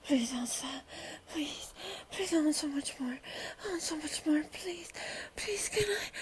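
low hushed pleading whisper. Real, not acted. Whispered begging.

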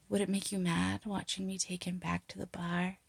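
ordinary talk, nothing marked

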